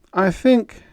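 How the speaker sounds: high, loud, choosing each word carefully; careful officer. Acted. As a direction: Roan measuring his words. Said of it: "he said carefully".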